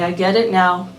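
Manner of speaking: plain reading voice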